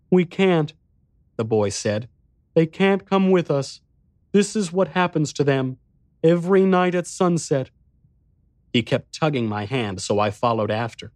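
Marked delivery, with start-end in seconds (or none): 0.1-0.7 put-on high child voice; little boy, grave and pitched well up; solemn young boy. A narrator's reading. Boy refusing the narrator's plea, solemn child voice. 2.5-7.7 put-on high, grave child voice; solemn young boy. A narrator's reading. Boy explaining the diners' fate, solemn child voice.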